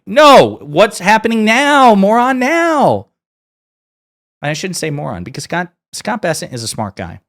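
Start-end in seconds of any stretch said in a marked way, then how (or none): 0.1-3.1 high, very loud, drawn-out yell; shouting down someone's foolish argument; exasperated shout. Acted. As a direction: bellow it, stretching each word. Yelling back at the flawed logic.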